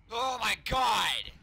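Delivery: loud, drawn-out exasperated cry; slow, angry outburst; venting rage. Real, not acted. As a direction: stretch it out in raging frustration. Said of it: "gonna vent my fucking rage".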